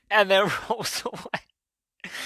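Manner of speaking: high, slow, helpless laughing speech; cracking up. Real, not acted. Finishing the critter joke, cracking up. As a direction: barely get it out through laughter. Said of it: "I'm already going".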